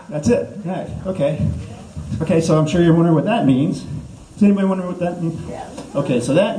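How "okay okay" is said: ordinary talk, nothing marked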